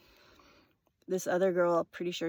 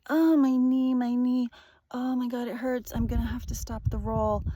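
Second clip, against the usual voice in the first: imitating him, louder and higher, whining about his knee; mock injured guy crying out; mock pained whine; 4 semitones higher, 4 dB louder than usual. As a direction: ham up his fake injury. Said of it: "he's like".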